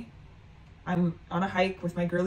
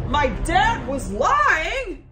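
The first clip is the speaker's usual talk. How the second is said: high, loud, very slow echo; shocked disbelief; 9 semitones higher, 7 dB louder, much slower than usual. Retold or imitated. Daughter learning dad lied, high incredulous echo. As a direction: act out stunned disbelief, stretching it right out.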